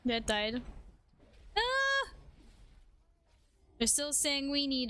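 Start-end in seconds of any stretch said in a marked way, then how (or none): 1.6-2.1 sudden high "ah"; high-pitched yelp; short high cry. Real, not acted. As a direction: cry out briefly, far above usual pitch. Sudden cry during the game.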